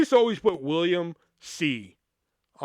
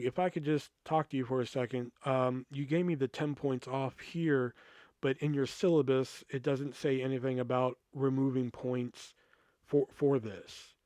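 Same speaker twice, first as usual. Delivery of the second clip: retold calm request; 5 semitones lower, 10 dB quieter than usual. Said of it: "and I was very calm".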